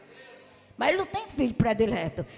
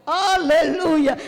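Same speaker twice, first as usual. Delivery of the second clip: drawn-out aleluia; 5 dB louder, much slower than usual. Real, not acted. Preacher praising God loudly.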